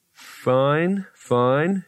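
slow, rising, wary acceptance; said slowly. A narrator's reading. Rincewind learns a rock is talking, says it slowly. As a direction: draw each word out and let it lift. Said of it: "he said slowly".

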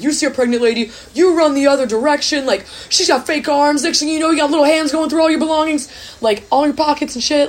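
high-pitched hammed-up Boston accent, a self-admitted bad attempt. Embassy man scaring students about pregnant pickpockets, bad mock Boston accent. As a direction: play the Boston embassy guy again, over the top. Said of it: "I can't do a Boston accent".

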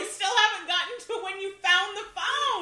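loud, slow, drawn-out Oh my god; groaning at how long this is taking; loud impatient exclamation; exasperated outburst. Real, not acted. Friend impatient with long story.

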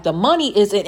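ordinary delivery

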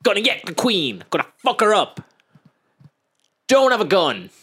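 high, punchy mock battle cry; hammed-up rallying of reckless plotters; loud, high, cocksure plotting voice; gung-ho bravado.

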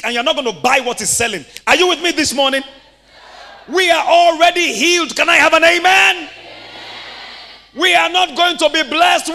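rally the church, shout it out; preaching at full force. Real, not acted. Pastor to congregation, fervent preaching.

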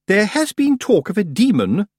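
high, affected solemnity; loud, portentous pronouncement; grave. A narrator's reading. Missus Earwig ignores Tiffany's explanation, grave. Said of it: "gravely ignoring this".

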